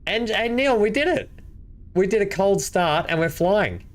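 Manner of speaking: high, proud and delighted; thrilled. Real, not acted. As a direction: announce the win to your friend. Cold start worked, telling Neil.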